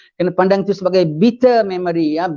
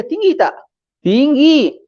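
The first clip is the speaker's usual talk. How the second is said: emphatic rhetorical; 7 semitones higher, slower than usual. Real, not acted. Rhetorical question and own answer.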